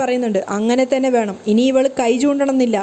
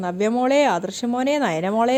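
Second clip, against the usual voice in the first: plain reading voice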